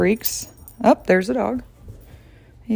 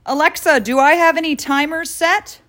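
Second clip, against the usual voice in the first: raised-pitch voice command; addressing a voice assistant; 8 semitones higher than usual. Real, not acted. Asking Alexa about timers, high voice-command tone. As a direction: call out a high, clear question.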